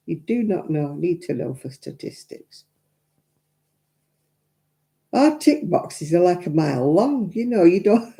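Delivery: ordinary delivery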